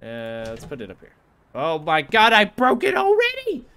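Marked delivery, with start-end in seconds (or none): 1.5-3.6 1 shocked outburst. Real, not acted. Cooking game mishap, exclaiming.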